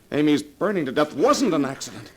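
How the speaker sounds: husband confronting his wife over the murder, escalating; rising furious accusation. Acted.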